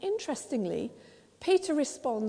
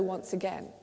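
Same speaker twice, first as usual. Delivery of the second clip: ordinary talk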